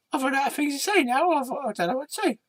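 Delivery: high puppet voice at a normal level; the little puppet going shy; red sock puppet. Acted.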